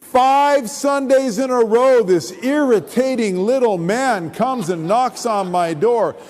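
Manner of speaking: ordinary talk, nothing marked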